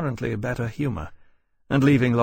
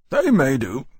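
louder, grudging approval; grudging senior officer; 3 semitones higher, 6 dB louder than usual. A narrator's reading. Commander passing judgment on the sea chest, grudging. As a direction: concede it with brusque authority.

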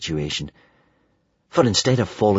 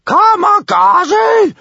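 very high, loud, drawn-out furious yell; furious scolding; 8 dB louder, much slower than usual. A narrator's reading. Bertha scolds her daughter, furious. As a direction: stretch the name into an angry shout. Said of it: "scolded Big Boobied Bertha furiously".

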